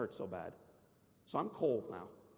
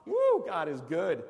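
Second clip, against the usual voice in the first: joyful cheer; 5 semitones higher, 8 dB louder, slower than usual. Pretend. Palm Sunday celebration.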